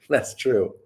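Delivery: chuckling through the words; amused agreement with a laugh. Real, not acted. Repeats it laughing.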